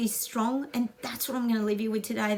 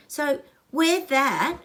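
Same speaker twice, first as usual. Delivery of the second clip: wear stretched slow and louder; slow emphatic urging; 4 semitones higher, 6 dB louder, much slower than usual. Real, not acted. Urging the Canadians to wear their pride, slow and emphatic. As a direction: press each word, slow and proud.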